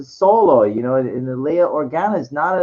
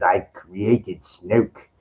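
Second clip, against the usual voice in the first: slow, lower, drawn-out Palpatine quote; Palpatine impression; 7 semitones lower, 4 dB quieter, much slower than usual. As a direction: drag each word out like the Emperor. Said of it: "And he goes".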